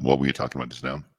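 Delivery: normal talking voice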